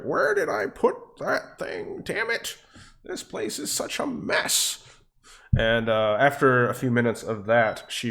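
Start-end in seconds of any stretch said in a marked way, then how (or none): 0.0-4.8 high, slow falsetto grumble; flustered woman. Acted. Cat's owner rifling through her bookcase, flustered. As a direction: fuss in a put-on high lady's voice. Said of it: "and she's like".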